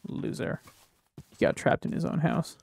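mocking whisper under the breath; gloating whisper to himself; whispered taunt. Real, not acted. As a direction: whisper a gloating jab at the mob. Mocking trapped mob.

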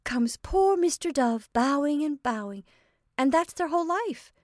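high, loud, animated exclamation; excited cry. A narrator's reading. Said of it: "cried Anne".

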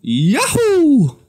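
very high, loud cartoon yell; jump-cheer like the plumber; squeaky game hero whoop; Mario impression. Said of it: "like Super Mario".

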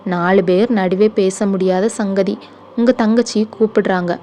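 ordinary delivery, nothing marked

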